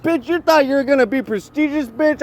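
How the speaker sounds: high-pitched mock yell at a rival; over-the-top taunting voice, pitched high; acted yelling. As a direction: ham it up like a trash-talking heckler.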